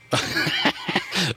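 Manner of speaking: short breathy chuckle; brief amused laugh at a joke. Real, not acted. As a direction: let out a quick, voiceless chuckle. Reacts to a listener's joke text.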